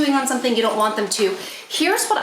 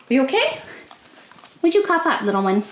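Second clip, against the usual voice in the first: pet talk; 4 semitones higher, faster than usual. Real, not acted. Talking to coughing dog.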